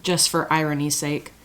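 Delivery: ordinary talk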